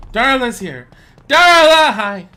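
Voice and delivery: high loud happy call; excited greeting. Real, not acted. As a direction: call out to her with delight.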